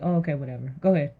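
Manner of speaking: low, offhand brush-off; dismissive. Acted. Wife humouring Noah about the ark, dismissive. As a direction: wave him off, unconvinced.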